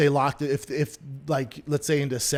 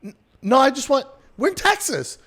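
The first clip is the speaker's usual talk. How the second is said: high, loud, stammered objection; baffled protest; 11 semitones higher, 6 dB louder than usual. Retold or imitated. Customer objecting to a mask rule in Texas, baffled. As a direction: retell a disbelieving protest, voice pitched way up. Retells his protest to hostile woman.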